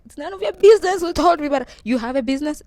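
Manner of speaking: high, loud retort acting the teacher; indignant teacher. Teacher to Amy about her secret business, indignant. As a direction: act out a teacher's sharp comeback. Teacher snaps back in retold confrontation.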